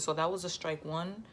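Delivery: ordinary talk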